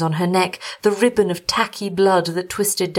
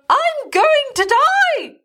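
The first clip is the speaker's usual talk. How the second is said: very high, slow, louder wail of despair; despairing cry; 4 dB louder, much slower than usual. A narrator's reading. Bitten wife cries to her husband, high despairing wail. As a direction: wail it out, sure of death. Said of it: "she said".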